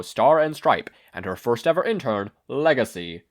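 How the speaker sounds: crisp broadcast anchor voice; news anchor read. A narrator's reading. TV anchor reporting the Hosu attack, news read. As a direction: deliver it like the evening news.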